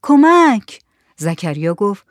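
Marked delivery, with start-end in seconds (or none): 0.0-0.8 high, loud cries for help; pleading wail. Acted. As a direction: wail it out, begging. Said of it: "به التماس دراز کرد و نالید".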